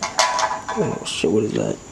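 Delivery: ordinary talk, nothing marked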